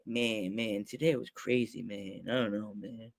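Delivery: low, soft, dazed mumbling; weary coworker. Retold or imitated. Justin on break after a crazy day, dazed. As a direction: imitate a worn-out friend, low and soft.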